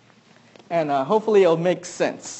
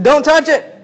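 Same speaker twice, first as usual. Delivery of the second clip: put-on raised, louder sharp warning to a toddler; dad warning his baby a second time, louder and firmer; 7 semitones higher, 6 dB louder than usual. Parent repeating a warning to the baby, raised and firm. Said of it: "I said".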